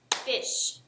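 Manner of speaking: "Fish" said softly as one beat with a clap; clapped word. Shown as a demonstration. Teacher clapping out fish with students. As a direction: breathe "Fish" out on a single clap. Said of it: "Now let's clap it out".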